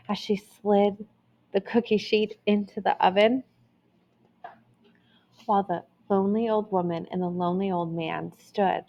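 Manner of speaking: usual speaking voice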